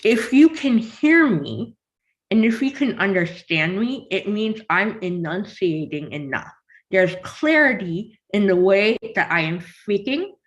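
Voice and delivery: talking with a pen between the teeth. Shown as a demonstration. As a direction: say it biting a pen horizontally. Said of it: "Put the pen in your mouth".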